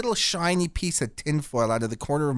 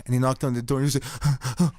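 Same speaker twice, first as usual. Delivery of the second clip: ordinary delivery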